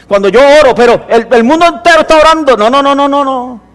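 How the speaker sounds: loud, high fervent sermon; fiery pulpit delivery; full-force preaching. Acted.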